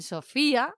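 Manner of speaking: "Sofía" with the i stressed, so-FÍ-a. Shown as a demonstration. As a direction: lean firmly on the í in "Sofía". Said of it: "la I A tiene importancia".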